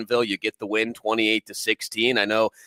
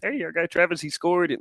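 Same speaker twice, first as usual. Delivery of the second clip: high, strained Kermit-like voice; Pat Mahomes impression; 7 semitones higher than usual. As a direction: squeeze it up into a froggy Kermit voice. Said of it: "we won't do the Pat Mahomes impression here".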